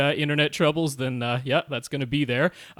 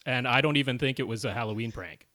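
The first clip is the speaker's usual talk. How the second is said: normal talking voice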